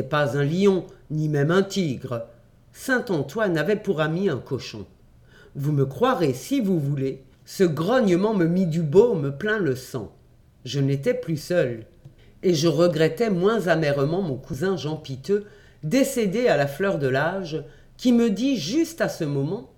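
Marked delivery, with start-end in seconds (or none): none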